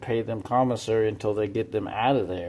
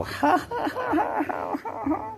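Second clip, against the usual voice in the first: shrill falsetto ha ha imitation, fading to breath; mocking high cackle; slower than usual. Imitating the friends' laugh at him, high mock cackle. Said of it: "like that".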